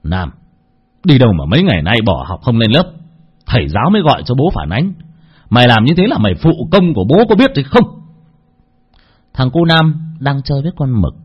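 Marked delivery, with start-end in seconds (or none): 0.0-8.0 a father fake-scolding his son for skipping school, louder; feigned anger. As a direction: pretend to be furious with the boy. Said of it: "giả đoạn giận dữ".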